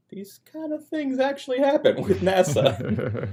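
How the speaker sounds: high, slow, sarcastic singsong; mocking sarcasm. Son mocking his father's faith in NASA, high and slow. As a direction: drag it out to mock the excuse.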